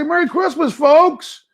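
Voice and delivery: high put-on dog voice; voicing the dog. Owner speaking for his dog to viewers, high put-on voice.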